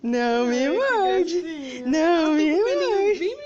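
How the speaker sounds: drawn out, half laughing; slow pleading. Acted. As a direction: stretch it out slowly to the pet. Repeated slowly to biting pet.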